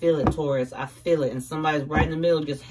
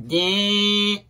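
horror movie ding; 3 semitones higher, 6 dB louder than usual. Retold or imitated. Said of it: "one of them horror movies".

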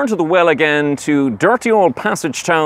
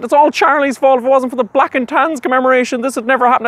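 whiny crying; 11 semitones higher than usual. Acted. Minister crying to him in the breakfast room, high whimpering whine. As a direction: wail it like a crying child. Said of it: "crying like a little girl".

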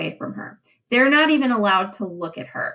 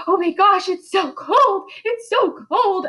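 high, thin, wailing cry of faked cold; breathless high wail, hammed-up terror; mock shivering fright; 9 semitones higher than usual. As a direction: play girls pretending to freeze, crying out. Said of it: "they all start going like this".

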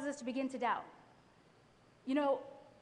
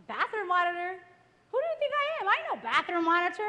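louder, offended and cocky; indignant inner voice; 4 semitones higher, 6 dB louder than usual. Retold or imitated. Young wife scoffing at the school's job offer, indignant. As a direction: scoff at the job like it's beneath you. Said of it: "that's what I'm feeling inside".

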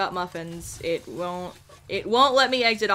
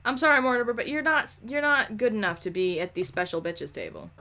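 ordinary talk, nothing marked

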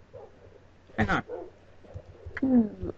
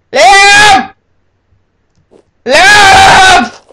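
loud call across the house; shouting a name; 7 semitones higher, 9 dB louder than usual. Real, not acted. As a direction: yell his name to find him. Calling out for missing brother.

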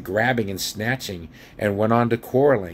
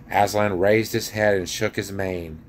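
usual speaking voice

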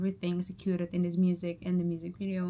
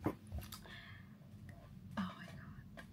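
breathless whispered awe; 20 dB quieter than usual. Real, not acted. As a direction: let it out in a hushed whisper. Overwhelmed by the music video.

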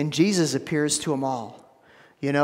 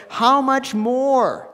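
how much more, drawn out high and slow; slow emphatic rise; 8 semitones higher, 4 dB louder, much slower than usual. Acted. Preacher building emphasis.

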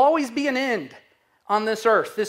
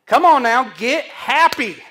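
much louder, slow and pressing; loud exhortation; 2 semitones higher, 9 dB louder than usual. Acted. Preacher rousing the congregation to rejoice, loudly. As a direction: shout it out to rouse the room.

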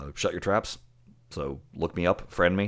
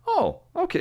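surprised. Retold or imitated. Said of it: "But it made me gotta go".

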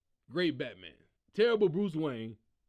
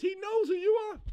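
mock disbelief; 12 semitones higher, 4 dB louder than usual. Real, not acted. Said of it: "why are you talking in the Batman voice".

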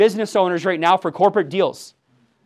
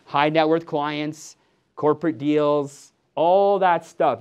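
slow and lower, each item landed; slow, weighty list; 6 semitones lower, 5 dB quieter, much slower than usual. Real, not acted. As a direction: drop the pitch and lean on every item. Listing payoffs, slowed for emphasis.

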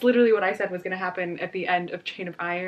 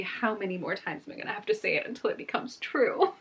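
ordinary talk

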